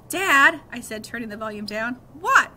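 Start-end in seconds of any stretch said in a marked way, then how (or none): 0.1-0.6 loud call over the music. A narrator's reading. Boy calling to his dad over the car stereo, loud. 2.1-2.5 loud, high what. A narrator's reading. Dad answering his son over loud music, loud.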